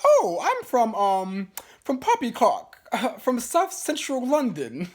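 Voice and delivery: mock British accent, pitched high. [name] claims to be from London, fake British accent. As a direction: put on an exaggerated, high British voice. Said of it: "questions [name] in her British accent".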